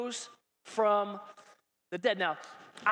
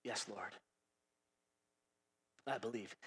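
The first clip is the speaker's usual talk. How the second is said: much lower and softer, nearly whispered; hushed whisper; 16 dB quieter than usual. Shown as a demonstration. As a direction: breathe it humbly. Repeats Martha's hushed reply.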